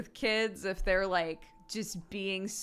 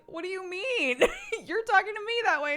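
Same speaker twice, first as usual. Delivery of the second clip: high-pitched, incredulous mock argument; retold parental exasperation; exasperated comeback; 13 semitones higher, 5 dB louder than usual. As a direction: argue back at the kid, pitch climbing. Retold parent arguing back.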